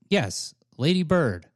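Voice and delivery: slow, halting declaration of love; heartfelt confession. Acted. Confessing love to Lady Bird, heartfelt. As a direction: confess it word by word, with long pauses. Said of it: "that was very heartfelt".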